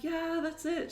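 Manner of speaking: high, drawn-out happy yes; delighted agreement. Retold or imitated. Family to Dad on picking the puppy, delighted. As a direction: squeal it lightly, sure this is the one.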